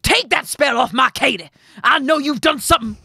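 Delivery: loud, high shouted demand; angry shout. A narrator's reading. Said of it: "and shouted at her".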